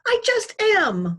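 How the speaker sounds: high, loud, slow shout; shouting mother. Acted. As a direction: snap back in anger. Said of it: "my mother shouted".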